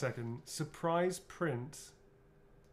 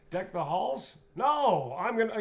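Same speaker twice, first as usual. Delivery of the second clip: Heisey voice; 7 semitones higher, 8 dB louder, slower than usual. Acted. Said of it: "How do we say that?".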